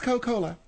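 "Coca-Cola" said as Co-Cola; dropped syllable. Shown as a demonstration. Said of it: "it's pronounced".

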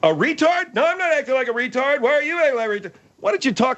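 high-pitched, outraged comeback; indignant yelling. Acted. Host insulted by Bud, indignant yelling. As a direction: hurl the insult back, pitched right up. Yelled quarrel with Bud continues.

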